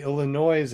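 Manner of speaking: Illinois ending in a "noise" sound; sounded final s. Retold or imitated. Quoting people who irk locals, Illinois as "illa-NOISE". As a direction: say Illinois with the s voiced. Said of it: "S at the end if you're not supposed".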